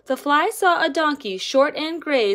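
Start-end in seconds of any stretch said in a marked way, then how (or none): none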